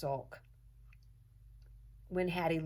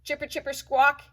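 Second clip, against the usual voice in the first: high, loud screeching chatter; mock squirrel screech; 10 semitones higher, 10 dB louder than usual. A narrator's reading. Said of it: "he screeches".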